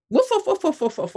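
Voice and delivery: playful mock woofs, a little yappy; imitating dog barks. Telling the host about a house full of poodles, mock barking. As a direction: bark the woofs like a pack of excited poodles. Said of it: "like barking".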